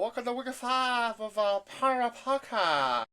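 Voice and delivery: high, slow showy podcast greeting; goofy announcer voice. Pretend. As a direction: ham up a silly host intro. Mock intro voice, high, slow.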